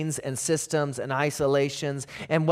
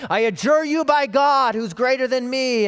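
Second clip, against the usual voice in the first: high, raised mock-demon plea; acted exorcism command, pitched up high; demon's adjuration; 8 semitones higher, 4 dB louder than usual. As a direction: call it out as a demon invoking God. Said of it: "the demons try to do that".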